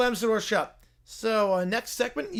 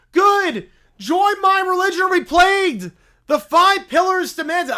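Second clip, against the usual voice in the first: loud bear preacher; 9 semitones higher, 10 dB louder than usual. Acted. Bear threatens the general.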